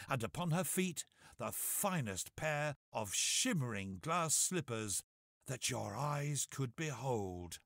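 ordinary delivery, nothing marked

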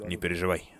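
ordinary delivery

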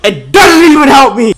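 high exasperated yell; crying with rage. Real, not acted. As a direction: shout it out in disbelief. Raging at the game.

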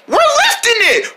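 very high squeaky echo; excited little friend. Pretend. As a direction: echo the cheer in a tiny voice.

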